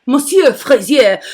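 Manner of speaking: playful French pronunciation with a uvular R; French accent. Pretend. Host re-saying her friend's name, put-on French accent. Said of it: "doing this French thing".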